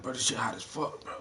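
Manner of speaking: low and subdued, barely voiced; hushed, half under the breath; quiet. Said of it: "He start whispering and shit".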